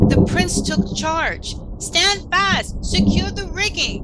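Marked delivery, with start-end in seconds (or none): 1.8-4.0 higher, louder shout of command; shouted orders. Acted. Prince commanding his crew in a storm, loud shout. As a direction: bark orders over the storm.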